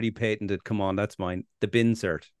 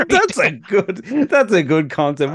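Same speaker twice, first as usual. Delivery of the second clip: laughing; 7 semitones higher, 6 dB louder, slower than usual. Real, not acted. Laughing at a joke title.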